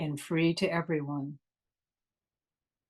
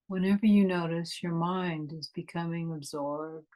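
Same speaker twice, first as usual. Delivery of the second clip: guided meditation. Acted. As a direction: ease them inward, unhurried and calm.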